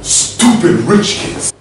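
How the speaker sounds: loud, slow burst of rage; angry outburst. Acted. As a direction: spit it out in comic fury. Rage outburst at rich kids.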